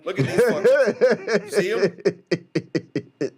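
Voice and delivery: loud, high, words breaking into laughter; laughing hard. Real, not acted. Host showing viewers his disgusted buddy, laughing loudly. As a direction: crack up while pointing it out.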